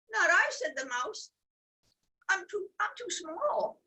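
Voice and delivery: squeaky high mouse voice; tiny mouse. Acted.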